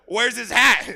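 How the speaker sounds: a jokey bellow of where's his hat; loud mock demand. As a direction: shout the punchline at her. Mocking repeat, laughing punchline.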